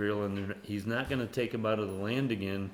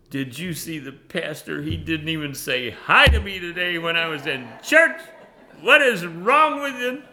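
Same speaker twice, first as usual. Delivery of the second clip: higher, louder put-on complainer voice; mock whiny churchgoer; 6 semitones higher, 9 dB louder than usual. Churchgoer griping that the pastor ignored him, mock whiny. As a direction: whine like someone snubbed at church.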